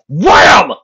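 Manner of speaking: loud Wham. A narrator's reading. Sound effect of push.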